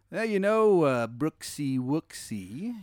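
high start, stretched-out slow nickname; genie. Acted. Genie wheedling the player with a pet name, slow and teasing. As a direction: tease out the pet name slowly. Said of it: "he goes".

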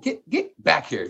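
sister calling back. Retold or imitated. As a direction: drag him back home with the words. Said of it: "She's like".

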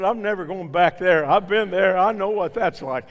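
loud, firm retelling of his refusal; defiant retort. Retold or imitated. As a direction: throw the refusal back hard and loud. Retold defiant reply to lady.

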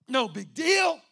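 loud, high brush-off, punching "deal"; breezy dismissal. Acted. Member brushing off the pastor's baby, loud and breezy. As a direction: wave it off loudly and cockily. Acts out careless baby holder.

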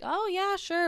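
a breezy high "sure" from a passerby; cheery stranger. Retold or imitated. Imitating the stranger's upbeat high yes to the boy. Two strangers accept the lemonade.

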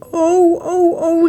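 high-pitched motherly wailing; panicked, high lament; distressed cry. A narrator's reading. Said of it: "riep ze".

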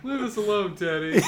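loud laughing yell; laughing shout. Pretend.

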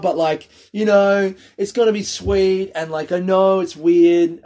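grin through your teeth and sell it; forced upbeat. Retold or imitated. Comedian reassuring a tiny crowd, forced grinning cheer. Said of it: "Just fucking grinning through my teeth".

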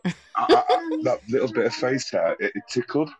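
high giggly voice, speeding up at the end; giggling. Real, not acted. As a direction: giggle through it, tickled by the memory. Giggling about beard tickle.

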